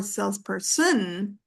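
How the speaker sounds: "salesperson" wrongly stressed sales-per-SON; misplaced stress. Shown as a demonstration. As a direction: shift the weight to the final SON in "salesperson". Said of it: "not salesperson".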